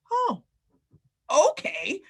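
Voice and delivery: surprised. Acted. Mock surprised realization.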